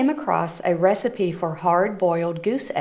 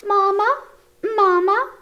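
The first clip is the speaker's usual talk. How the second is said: much higher, louder squeaky calls; baby goslings; 4 dB louder than usual. Acted. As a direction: chirp it like little goslings. Goslings follow Bruce.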